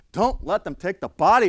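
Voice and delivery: loud, high retold yell. Medical examiner to deputy over the phone, yelling. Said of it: "the Emmy's yelling".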